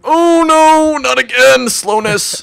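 loud high wail of dismay; dismayed shout. Real, not acted. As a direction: yell out in mock despair. Potion effect hits again.

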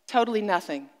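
ordinary talk, nothing marked

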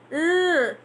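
usual speaking voice

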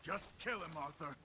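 quiet, softly delivered order. Acted. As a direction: say it softly to your partner. Companion urges killing.

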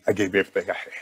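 low, choked, near-breaking voice; fighting tears, pushing each word out softly; holding back tears. Real, not acted. Thanking his co-host while fighting back tears, choked. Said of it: "I'm gonna cry in the car".

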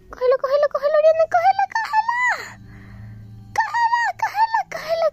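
high laughing shouts; excited shouting. Real, not acted. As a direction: squeal with excitement. Urging herself to grab antidote.

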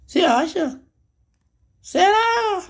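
very high, squeaky put-on voice; mock a doubter in a tiny high voice. Teacher mocking a doubtful viewer in a squeaky voice.

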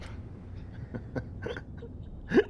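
breathless high giggle; high squeaky laugh. Real, not acted. Laughing at the meds line.

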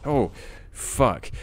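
high, loud shocked curse; startled curse. Real, not acted. Streamer caught off guard by the horror game, cursing in shock. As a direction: blurt it out in fright. Jumpscare while playing.

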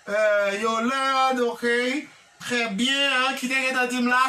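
ordinary talk

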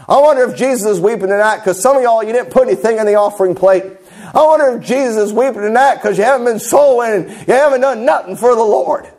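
building mock revival lament, pitch climbing; mock camp meeting preacher. Shown as a demonstration. Preacher mimicking a revivalist scolding non-soul-winners, rising mock preaching. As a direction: parody a preacher guilt-tripping the flock, rising with each charge. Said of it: "I could preach like that all night".

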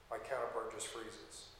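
normal talking voice

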